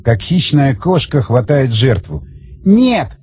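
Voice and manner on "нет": high cry of refusal; contained scream, pitch jumping up. A narrator's reading. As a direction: protest in a desperate cry. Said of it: "закричала она".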